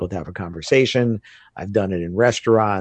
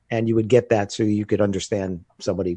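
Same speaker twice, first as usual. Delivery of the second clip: usual speaking voice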